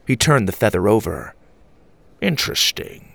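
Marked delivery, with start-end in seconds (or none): 2.2-3.1 breathy, hushed "Interesting"; whispered musing. A narrator's reading. Grandfather examining the feather, hushed. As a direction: murmur it to yourself.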